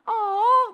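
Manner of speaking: high loud oh; mock coo. Shown as a demonstration. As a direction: coo it for the crowd. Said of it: "You guys wanna do that with me".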